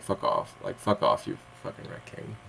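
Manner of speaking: low, dropping to a whisper on you fucking raccoon; fed-up mutter. Retold or imitated. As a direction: grumble it under your breath at the raccoon.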